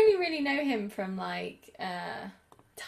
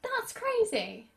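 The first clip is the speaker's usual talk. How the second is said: amazed; 11 semitones higher, much slower than usual. Real, not acted. Amazed reaction, slow high.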